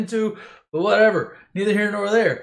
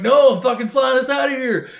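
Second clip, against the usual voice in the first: loud, high mock outburst; exasperated Han Solo; 7 semitones higher, 7 dB louder than usual. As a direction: overrule everyone at a raised pitch. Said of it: "And Han's like".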